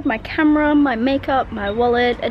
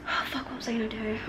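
whisper; 12 dB quieter, faster than usual. Real, not acted. Forgets her plan.

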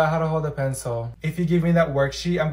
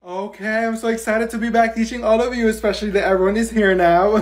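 excited teacher; 4 semitones higher, 6 dB louder than usual. Acted. Teacher greets class cheerfully.

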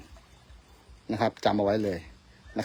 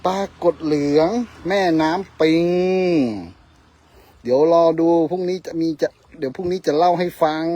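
louder, higher showing off the catch; proud announcement; 6 semitones higher, 7 dB louder than usual. Real, not acted. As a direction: present the fish with pride.

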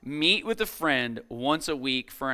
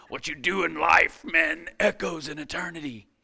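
put-on Gladiator accent; 2 semitones higher than usual. Preacher redoing a line from a movie for his audience, in a put-on Gladiator accent. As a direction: declaim it in a mock film-gladiator accent. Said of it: "he kinda has a better accent than that".

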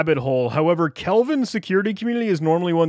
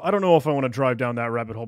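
ordinary delivery

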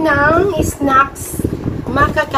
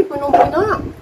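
ordinary talk

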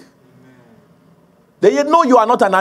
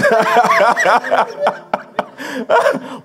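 long, hearty laugh; real laughter; 7 semitones higher than usual. Real, not acted. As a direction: laugh freely at your own joke. Laughs at own joke.

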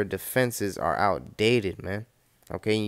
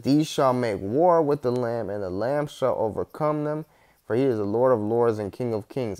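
emphatic scripture reading; 2 semitones higher than usual. Acted. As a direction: declare it like a prophecy. First reading of verse.

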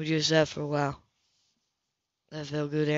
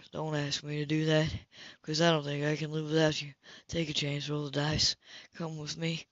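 soft tender plea; 4 semitones lower, 8 dB quieter than usual. Acted. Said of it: "he lowered his brow".

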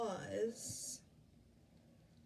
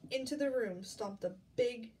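plain reading voice

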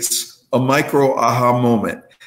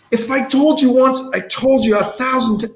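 blowing up; 10 semitones higher than usual. Retold or imitated. Carpenter ranting at his girlfriend, cut off mid-word, exasperated. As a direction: build to a rant, then stop dead.

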